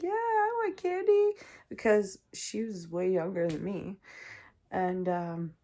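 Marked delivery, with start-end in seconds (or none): 0.0-1.3 little sister. Retold or imitated. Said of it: "my sister was like".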